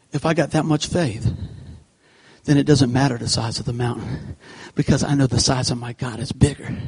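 normal talking voice

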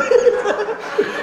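high, loud laugh burst. Real, not acted. At the clerk's line, a loud laugh. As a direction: let out a big laugh.

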